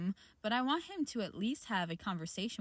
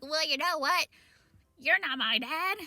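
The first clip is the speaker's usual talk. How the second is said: high, loud mock retort from a grown child; mocking a sassy grown child; 6 semitones higher, 6 dB louder than usual. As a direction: fire back like a sassy kid. Adult child retorting to parent.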